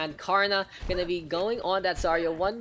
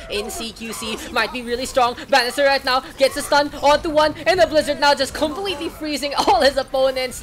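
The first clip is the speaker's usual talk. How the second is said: louder, higher play-by-play call; hyped casting; 8 semitones higher, 8 dB louder than usual. Acted. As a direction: ride the hype of the teamfight. Hype play-by-play peak.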